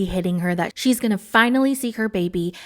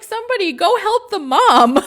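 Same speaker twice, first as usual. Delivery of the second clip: high, loud call for help; laughing plea; 10 semitones higher, 5 dB louder than usual. Acted. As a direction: call out in amused, mock alarm. Laughing plea for the struggling mom.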